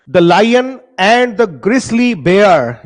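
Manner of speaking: poem recital. Shown as a demonstration. Teacher reciting poem line.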